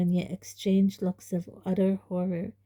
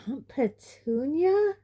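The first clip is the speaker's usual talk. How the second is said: astonished; 7 semitones higher, slower than usual. A narrator's reading. Harry's stunned thought.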